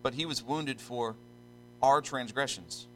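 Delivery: "our" set off by a pause and stressed. Real, not acted. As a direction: pause after "for", then lean slowly on "our". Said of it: "I emphasize the word our".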